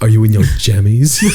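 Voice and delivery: breathy mock phone-sex voice; hammed-up sultry. Friend mocking the sleep guru as a phone-sex voice. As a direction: tease like a creepy late-night caller. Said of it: "He's like".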